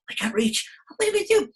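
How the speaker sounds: high, loud hammed-up despair; squeaky flower, mock panic. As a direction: wail like a stuck little flower.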